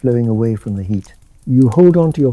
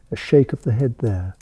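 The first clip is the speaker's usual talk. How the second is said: ordinary delivery, nothing marked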